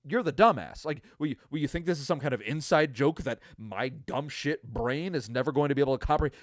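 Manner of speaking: usual speaking voice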